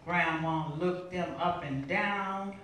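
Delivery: high, forceful spoken-word delivery; dramatic poetry reading at full intensity; impassioned poem recitation. Acted. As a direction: drive the verse hard, pitch lifted.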